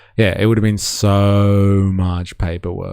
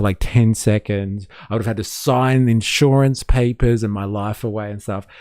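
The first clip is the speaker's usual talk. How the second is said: normal talking voice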